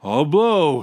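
loud, high heroic shout. A narrator's reading. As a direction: shout it out like an announcement. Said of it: "he shouted".

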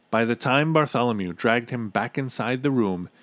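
plain reading voice

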